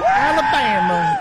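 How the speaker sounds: high, slow mock Southern Alabama; mock Southern drawl. Host mocking the congresswoman's put-on Southern accent. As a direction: stretch it out like a twangy Southern politician. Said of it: "All right, enough, [name]. I can't take it".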